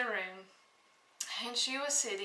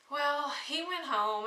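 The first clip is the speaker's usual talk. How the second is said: plain reading voice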